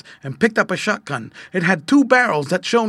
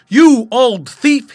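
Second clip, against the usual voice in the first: loud, slow, high accusing shout; angry call; 9 semitones higher, 13 dB louder, much slower than usual. A narrator's reading. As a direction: call it out at a thief, drawing out each word. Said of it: "he called".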